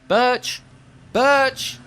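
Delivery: high call across a field. Acted. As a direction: call for him from far away.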